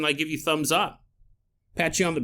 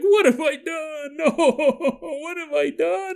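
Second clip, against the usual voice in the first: high, slow wailing sob; hammed-up weeping despair; sobbing stranger in the next stall; mock sobbing lament; 10 semitones higher, slower than usual. Acted sobbing woman's lament.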